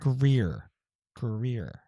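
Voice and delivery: Greer said slowly and carefully on its own; careful pronunciation. Shown as a demonstration. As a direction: practise the tricky r sounds in Greer. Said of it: "That's hard to say".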